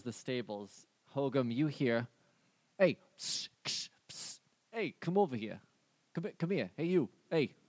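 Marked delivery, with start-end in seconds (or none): 2.8-3.5 psst for attention. Acted. GM voices stranger calling. 3.6-4.4 whispered psst, psst; psst for attention. Acted. Hissing psst to call someone. 4.7-7.5 psst for attention. Acted. GM voices stranger calling.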